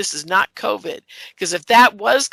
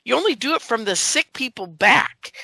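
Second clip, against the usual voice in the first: normal talking voice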